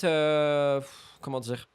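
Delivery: normal talking voice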